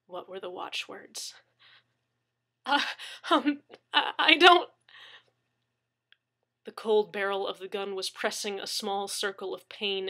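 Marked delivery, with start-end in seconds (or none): 2.6-4.7 terrified stammer. Acted.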